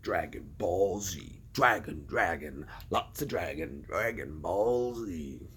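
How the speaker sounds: low, slow, drawn-out show title; title announcer. Acted. Streamer riffing on Dragon Ball Z, low announcer voice. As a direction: intone it softly like a deep trailer voice.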